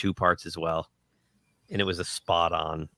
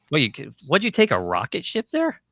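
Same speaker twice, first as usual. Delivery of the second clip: teasing; 8 semitones higher, 4 dB louder than usual. Real, not acted. Teasing arriving friend.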